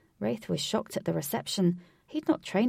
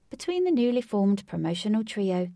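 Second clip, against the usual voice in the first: ordinary delivery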